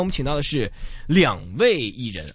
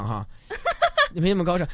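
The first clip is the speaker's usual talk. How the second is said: laughing; 4 semitones higher, much faster than usual. Real, not acted. Host laughs at own joke.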